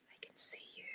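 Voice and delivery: very soft whispered aside; playful whisper. Real, not acted. Host to unseen live viewers she knows are there, playful whisper. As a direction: whisper it as if spying on them.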